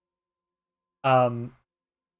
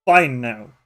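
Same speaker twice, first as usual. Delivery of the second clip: louder, bossy badger voice; gruff old badger; 3 semitones higher, 6 dB louder than usual. Badger Nullig correcting the boy, loud and bossy.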